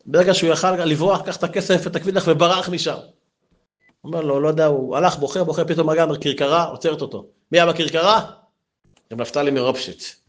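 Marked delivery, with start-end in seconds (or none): none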